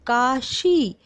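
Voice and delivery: "काशी" with both vowels held and शी pushed; stressed long ई. Shown as a demonstration. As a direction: press on the long ee ending of "काशी". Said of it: "जोर दे के बोली जा रही है". ("काशी" में शी को ज़ोर से खींचकर)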